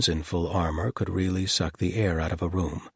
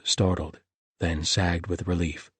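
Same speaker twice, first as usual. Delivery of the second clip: ordinary delivery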